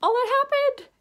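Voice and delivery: indignant reader ranting; disbelieving rant. Retold or imitated. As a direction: shout your shock at the plot.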